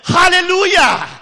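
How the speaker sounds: very loud, high ecstatic shout; the loudest hallelujah ever heard; biggest hallelujah. Retold or imitated. Poor man leaping up in church, ecstatic shout. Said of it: "at the top of his voice".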